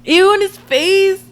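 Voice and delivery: high, loud slow disgusted ew. Real, not acted. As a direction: squeal in disgust. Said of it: "That made me disgusted".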